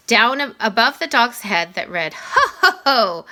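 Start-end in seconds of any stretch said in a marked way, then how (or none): 2.1-3.3 high jolly Santa laugh, lightly done; a playful cartoon-dog Santa.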